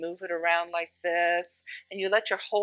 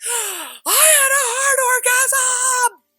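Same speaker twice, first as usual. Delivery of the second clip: much higher, loud, slow, thrilled cry; excited shout; 9 dB louder, much slower than usual. Retold or imitated. Friend phones her with the news, thrilled shout. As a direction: shout it like the best news ever. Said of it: "she was so excited".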